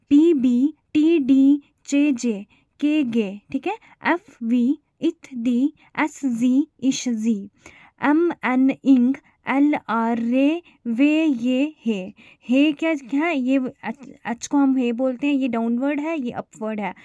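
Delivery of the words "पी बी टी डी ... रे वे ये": each sound said clearly and loud; reciting consonant sounds. Shown as a demonstration. Teacher drilling the consonant list for students, clear and loud. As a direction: read out the shorthand consonants one by one. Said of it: "अब कौन कौन से हैं".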